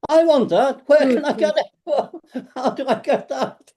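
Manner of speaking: mock-excited Liverpool cabbie wanting it now; eager cab driver. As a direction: play the cab driver suddenly hooked and laughing. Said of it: "And they go".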